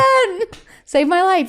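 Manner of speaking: high, loud, gushing thanks; gushing praise. Retold or imitated. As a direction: gush at him like a fan. Retold gushing to Carson.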